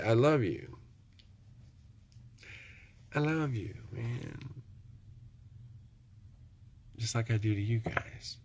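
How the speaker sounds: tender self-talk. Shown as a demonstration. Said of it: "You gotta say".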